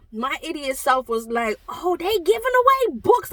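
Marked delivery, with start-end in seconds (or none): 1.7-3.3 eager delight. Retold or imitated. Her eager self at the book table.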